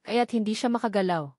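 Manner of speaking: ordinary delivery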